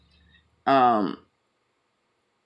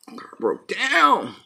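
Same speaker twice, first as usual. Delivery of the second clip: "Car broke down" drawn out slow and higher; mock dramatic; 6 semitones higher, much slower than usual. Real, not acted. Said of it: "repeats the phrase".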